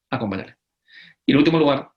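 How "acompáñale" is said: Acompáñale said lower and softer, sinking in; Acompáñale, a quiet echo of the call; soft repeat. Shown as a demonstration. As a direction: let Acompáñale fall gently, like advice to keep.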